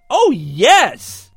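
put-on high, loud gleeful girlish cry. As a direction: cry it out with glee. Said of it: "Kelly cries out gleefully".

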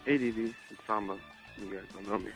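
usual speaking voice

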